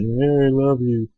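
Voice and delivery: mocking a guy serenading his girl; drawn-out sappy love line, mocking; mock cheesy love song. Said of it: "sing 'em cheesy songs".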